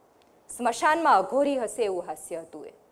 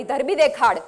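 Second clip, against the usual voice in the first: louder fierce dare on ધરબી દેખાડ; bone-chilling scream; 3 semitones higher, 8 dB louder than usual. A narrator's reading. Woman daring the man to bury her, loud taunting cry. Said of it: "લીલાએ હાડ થીજાવી દેવી રાડ પાડી".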